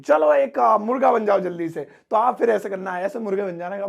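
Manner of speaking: ordinary delivery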